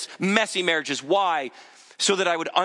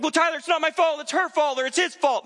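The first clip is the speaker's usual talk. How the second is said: defensive excuse; 11 semitones higher than usual. Retold or imitated. Imagined listener making excuses.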